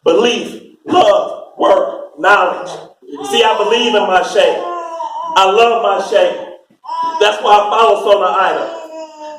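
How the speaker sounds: ordinary talk, nothing marked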